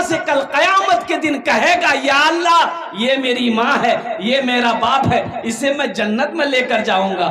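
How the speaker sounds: high, ringing sermon delivery; impassioned preaching. Acted. Preacher recounts a bereaved mother's patience before Allah, impassioned preaching.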